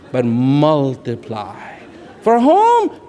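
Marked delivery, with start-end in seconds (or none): 2.2-3.1 flung out high and loud; a sharp, raised question to the listeners; loud rhetorical challenge. Real, not acted. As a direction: challenge the crowd with it.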